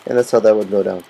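ordinary talk

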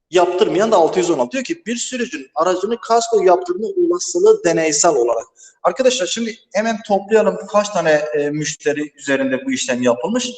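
ordinary talk, nothing marked

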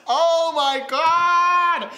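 loud, high, hugely drawn-out exclamation; long, loud cry of embarrassment; mortified outcry. Real, not acted. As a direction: wail it out in real cringing disbelief. Cringing at own old cover.

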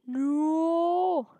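high, loud mock-despair cry; playful howl of frustration; drawn-out wailed no. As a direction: wail it, pitch climbing then dropping. Thwarted while chasing in game.

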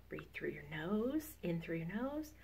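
calm them into the breath; guided breathing. Acted. Leads a mindful minute.

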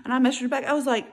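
normal talking voice

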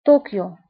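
French-style طوكيو, short and falling; French pronunciation. Shown as a demonstration. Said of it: "بالفرنسي أيضًا".

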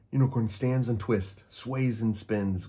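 plain reading voice

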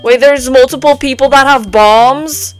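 ordinary talk

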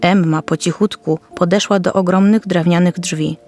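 plain reading voice

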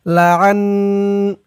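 লাআন- with the ن held nasally; nasal ghunna. Shown as a demonstration. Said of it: "ওয়াজিব গুন্নাহ". (লাআন-এ নূন গুন্নাহ সহ টেনে)

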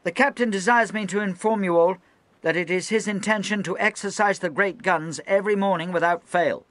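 high, loud formal proclamation; anxious officer announcing. Acted. Young lieutenant announcing drills to the crew, high and loud. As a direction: read out the captain's orders to all hands. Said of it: "shrill with anxiety".

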